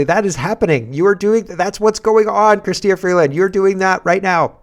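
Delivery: high, loud indignant heckle; mimicking Conservatives heckling Freeland; heckling shout. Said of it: "you can hear the Conservatives say".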